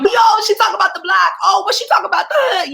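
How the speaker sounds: high, loud imitation of excited kids; mock excited youngsters. Poet imitating young readers reacting to the book, excited.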